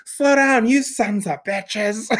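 high, drawn-out cry of outrage, played for laughs; imitated yelling. Grandmother cursing at a driver, mock yelling.

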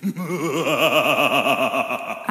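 deep villainous chuckle; a low menacing laugh; low evil laugh. Acted. Evil laugh after demons line.

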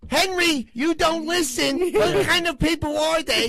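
high, loud, slow mock-scolding film voice; movie character impression. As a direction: scold Henry like the gangster movie scene. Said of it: "he's like".

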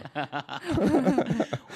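high bursting laugh; loud laugh at the mail; burst of laughter. Real, not acted. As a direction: crack up loudly.

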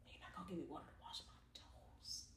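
barely audible unvoiced whisper. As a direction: let it fade to a breath. Repeating the whispered complaint.

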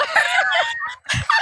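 high, shrieking burst of laughter; big laugh. Real, not acted. Cracking up over a muddled accent, high laughter.

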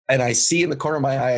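ordinary delivery

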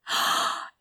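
sharp, breath-only gasp of recognition. A narrator's reading. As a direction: gasp as if star-struck. Woman recognises the author.

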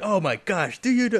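high, agitated chief impression; police chief. Host mimics police chief in the opening, mock-alarmed. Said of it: "like the police chief at the beginning".

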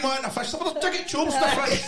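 high hammed-up street seller call; mock vendor. Mates joking, hawking jigget chops as a market trader.

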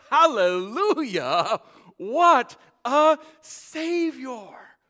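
very loud, high, slow climax; full-force preaching. Acted. Preacher crying Isaiah's woe and hallelujah to the congregation. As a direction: cry it out to the church.

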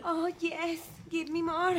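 heavy Colombian accent in English; Sofía Vergara. Shown as a demonstration. Showing her friend how it goes, Colombian-accented mock moaning. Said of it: "acento de Sofía Vergara".

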